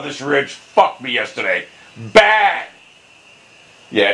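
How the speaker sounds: ordinary delivery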